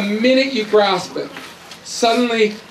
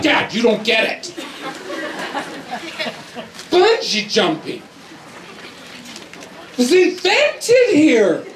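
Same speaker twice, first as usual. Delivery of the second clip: put-on pitched-up insistent kid, laughing through it; teen son insisting; 3 semitones higher than usual. Son insisting to his dad, excited. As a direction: press the point like an eager teen.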